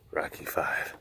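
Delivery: whispered aside; whispered disdain. Real, not acted. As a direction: hush it like a dirty secret.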